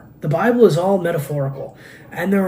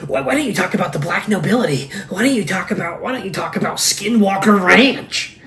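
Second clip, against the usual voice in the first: higher, whiny mock voice of conspiracy commenters; 4 semitones higher, 3 dB louder than usual. Mocking YouTube commenters who demand conspiracy topics, in a higher nagging voice. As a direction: needle him like a pestering online commenter. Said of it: "these people, like, they".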